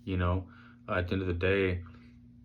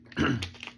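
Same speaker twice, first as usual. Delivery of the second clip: throat clearing; 7 semitones higher than usual. Real, not acted.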